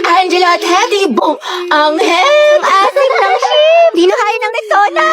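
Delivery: high squeal stretched out on the ship; kilig cheering. Acted. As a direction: cheer on the love team, shrieking. Friends loudly ship the couple. (tiling pang-asar na kinikilig)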